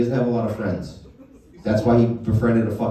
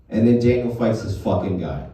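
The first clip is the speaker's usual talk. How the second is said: usual speaking voice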